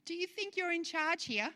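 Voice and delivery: high, incredulous question; indignant challenge. Acted. Preacher voicing James questioning the planners, incredulous. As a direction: challenge their presumption. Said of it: "James is saying".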